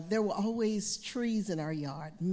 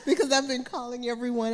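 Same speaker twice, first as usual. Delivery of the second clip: usual speaking voice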